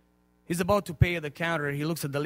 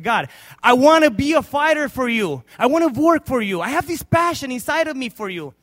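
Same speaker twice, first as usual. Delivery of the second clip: loud, high cry to God; fervent preached prayer; 9 semitones higher, 12 dB louder than usual. Acted. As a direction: cry out your passion to God. Old man's prayer preached.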